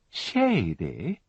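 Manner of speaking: "Shady?" drawn out with an outraged rising tune; scandalised echo. A narrator's reading. Widow echoing the inspector's word, "Shady?" rising in outrage. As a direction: echo "Shady?" like Lady Bracknell's "A handbag". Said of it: "She said it as Lady Bracknell said, "A handbag".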